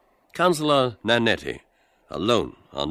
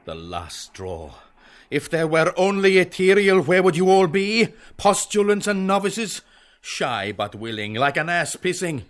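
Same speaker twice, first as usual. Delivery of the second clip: cold anger; 9 semitones higher, 5 dB louder than usual. Acted. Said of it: "Bloom, coldly".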